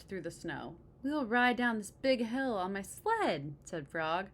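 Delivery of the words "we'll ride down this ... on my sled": excited. Acted. Frog proposing sled ride.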